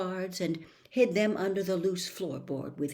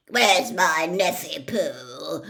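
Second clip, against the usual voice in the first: slow, louder, drawn-out fond call; doting Aunt Marge; 6 dB louder, slower than usual. Acted. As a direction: coo for her darling nephew at full volume. Aunt Marge calling nephew.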